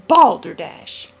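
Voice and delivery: loud, slow "Balderdash!"; angry outburst. A narrator's reading. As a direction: blast the word out in irritation. Old man explodes in anger.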